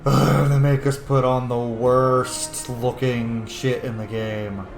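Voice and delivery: ordinary talk, nothing marked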